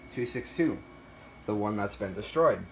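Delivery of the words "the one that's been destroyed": normal talking voice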